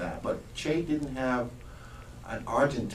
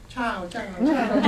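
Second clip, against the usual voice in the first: high-pitched laughing mockery of the Argentine goodbye; mocking Cubans' ciao; 13 semitones higher, 7 dB louder, slower than usual. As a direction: squeal it, teasing the visitor. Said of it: "started laughing and started going".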